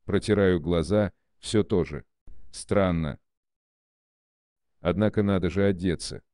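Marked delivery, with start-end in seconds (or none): none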